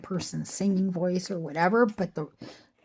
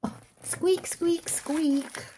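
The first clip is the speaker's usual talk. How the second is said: high, breathy whispered squeaks; chair squeak imitation; 9 semitones higher, 4 dB quieter, much slower than usual. Copying her old chair's squeak for viewers, high squeaks.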